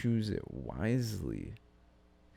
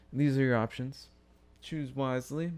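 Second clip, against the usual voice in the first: mock announcer; 6 semitones higher, 4 dB louder than usual. Mock presenter of election candidates.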